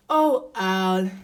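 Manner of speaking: loud, heavy lament; woeful Elephant. A narrator's reading. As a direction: wail a friend's name in self-pity.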